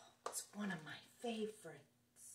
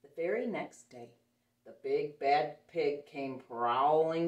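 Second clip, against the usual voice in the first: story narration; 3 semitones lower, 9 dB louder than usual. A narrator's reading. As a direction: read the pig's approach big and ominous. Reads picture book aloud.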